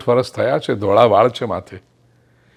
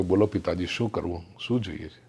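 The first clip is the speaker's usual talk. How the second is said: soft, lowered, respectful reply to father; humble son; 5 semitones lower, 9 dB quieter than usual. Acted. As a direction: answer gently like an obedient son. Obedient youngest son answers father. (નમ્ર, ધીમા અવાજે આજ્ઞાકારી દીકરો)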